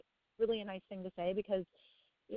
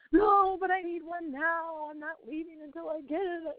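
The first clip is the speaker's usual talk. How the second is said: put-on high, slow, pleading child voice; whiny kid; 8 semitones higher than usual. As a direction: play the little son begging for a cookie. Son whining for a cookie.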